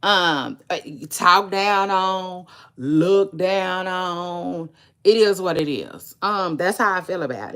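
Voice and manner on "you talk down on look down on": slow, louder, words drawn out; slow emphatic correction. Real, not acted. Correcting herself to her viewers, slow and loud. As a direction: spell it out slowly and firmly. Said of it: "self-correction drawn out".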